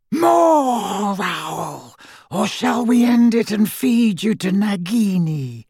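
icy high-pitched cruel voice; high, cold, merciless. A narrator's reading. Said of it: "spoke in a high, cold, merciless voice".

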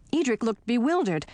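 plain reading voice

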